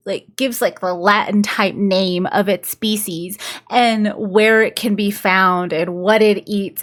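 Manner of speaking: ordinary delivery, nothing marked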